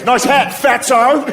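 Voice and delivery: high-pitched Australian heckler; mock Australian accent. Australian goth mocking a stranger's bucket hat in passing, high Australian accent. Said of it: "he's a goth from Australia".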